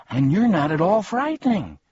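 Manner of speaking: high, loud accusation; indignant. A narrator's reading. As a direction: cap the protest with high indignation. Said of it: "said Milo indignantly".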